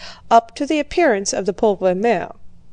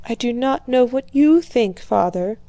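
normal talking voice

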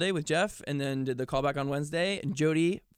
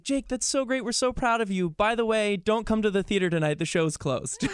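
chirpy email voice; 7 semitones higher than usual. Retold or imitated. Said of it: "an email back that was like".

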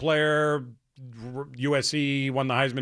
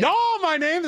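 high-pitched, botched Norwegian voice; attempted Norwegian accent; 3 dB louder than usual. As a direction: start a mock Norwegian accent, badly faked.

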